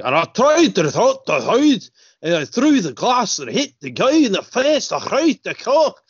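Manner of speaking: Scottish Trainspotting-style rant; Scottish accent. Retold or imitated. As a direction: do a hammed-up Scottish tough guy. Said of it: "do you remember him in Trainspotting".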